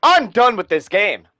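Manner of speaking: loud, slow, fed-up yell; raging gamer. Real, not acted. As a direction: shout it out in disgust. Raging after in-game death.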